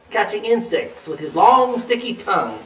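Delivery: usual speaking voice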